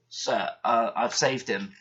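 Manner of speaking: plain reading voice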